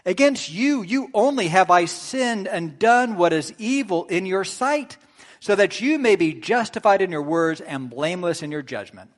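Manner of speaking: loud, soaring psalm read from the pulpit; fervent scripture reading. Acted. Preacher reading the psalm of confession to the congregation, loud and fervent. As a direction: proclaim it with full preaching force.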